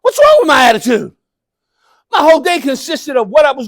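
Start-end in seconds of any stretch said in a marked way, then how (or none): 0.0-1.1 high, loud incredulous retort; indignant younger self. Retold or imitated. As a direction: protest much higher, like a defensive teen. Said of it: "I said".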